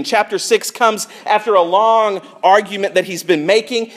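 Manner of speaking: usual speaking voice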